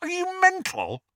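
very high, incredulous outburst; loud disbelief. A narrator's reading. Friend challenging Harry's refusal, high incredulous outburst. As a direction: squeal it in disbelief. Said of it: "said Ron loudly".

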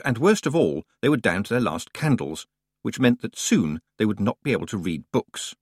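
usual speaking voice

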